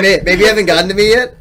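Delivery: loud, high, joking self-quote; mock protest. Joking about being skipped on Tinder, mock protest. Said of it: "Uh, I'm like".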